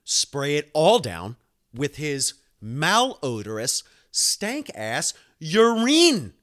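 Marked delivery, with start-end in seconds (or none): 2.6-6.3 high, loud, very slow disgust. Real, not acted. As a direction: drag it out in disgust. Disgusted emphasis.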